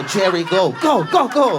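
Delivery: rising to high loud urging; comic playing an action hero urging Jerry on, getting high and loud; urgent order. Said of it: "I go".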